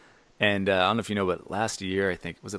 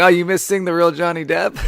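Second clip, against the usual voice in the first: mocking tease; 8 semitones higher, 8 dB louder than usual. Teasing friends who missed Depp.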